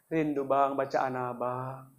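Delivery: very soft, low, slow lament; imitated tearful mother. Crying mother misses her son's recitation, imitated hushed sorrow. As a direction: murmur it slowly as a weeping mother. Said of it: "Mama nangis".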